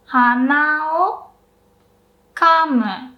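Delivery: hana o kamu slowly, na o high, kamu rising then falling; nose meaning, na and o kept high; blow nose version. Shown as a demonstration. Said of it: "So for blowing, uh, your nose".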